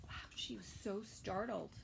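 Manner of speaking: soft unvoiced whisper; whispered aside. Real, not acted. Reader whispering an aside to the children. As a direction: confide it in a whisper.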